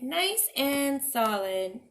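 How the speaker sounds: slow, pleased repeat; satisfied pig. Acted. Pig admiring his brick house, slow and satisfied. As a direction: savour the words.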